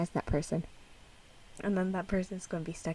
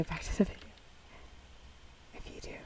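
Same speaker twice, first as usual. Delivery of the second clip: usual speaking voice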